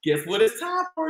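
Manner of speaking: plain reading voice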